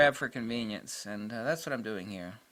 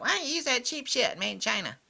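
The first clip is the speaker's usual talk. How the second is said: high-pitched mock questioner; mocking critic; 11 semitones higher than usual. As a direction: parody a critic in a high put-on voice. Mimics critics he finds amusing.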